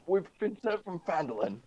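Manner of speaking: called out high across the gap; shouting out. Acted. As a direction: shout it toward the guard. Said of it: "I'll shout in Dwarvish".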